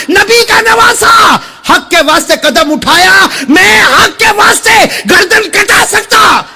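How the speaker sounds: high, shouted sermon building in pitch; fiery preaching. Acted. Preacher retelling Husain's refusal to turn back, fiery preaching. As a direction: shout the pleas and the defiant answer, stretching key words.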